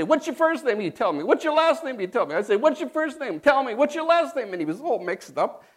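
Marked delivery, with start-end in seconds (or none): 0.0-4.5 a mock taunting kid, pitched higher; bully the boy with mock questions; teasing kid voice. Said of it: "I would say".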